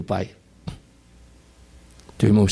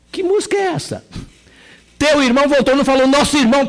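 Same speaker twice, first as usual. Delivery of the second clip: higher and louder servant's voice; the servant tells the older brother, raised and loud; servant's announcement; 11 semitones higher, 3 dB louder than usual. Acted. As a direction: announce it like a servant keeping his distance. Servant and brother dialogue acted.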